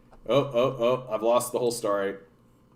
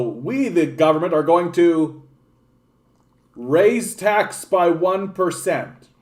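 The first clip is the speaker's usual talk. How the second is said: slow, grand mock address, a bit louder; pompous politician; 4 semitones higher, 3 dB louder, slower than usual. Streamer mocks the Liberal government addressing voters, pompous announcement. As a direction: announce it like a stiff official.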